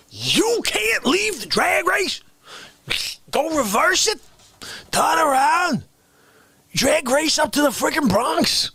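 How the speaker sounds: high, shouted disbelief; hammed-up furious rant; mock outrage. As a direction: yell it in indignation. Outraged at drag racer.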